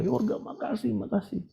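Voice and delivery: high, soft mimicked thanks; grateful relative. Relative hugging the preacher after a healing, imitated high thanks. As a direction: imitate someone gushing thanks in a small high voice. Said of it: "Wah, dia peluk saya".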